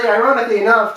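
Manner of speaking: ordinary delivery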